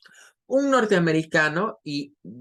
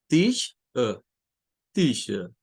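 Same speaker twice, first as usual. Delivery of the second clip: British dropped r; 2 semitones lower, slower than usual. Shown as a demonstration. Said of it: "Ellos al final solo dicen".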